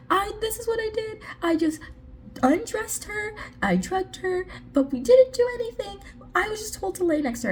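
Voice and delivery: high, hurried mock excuses; defensive culprit imitation. Prisoner excusing himself under questioning, high mock-defensive voice. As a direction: squeak out a panicky defence.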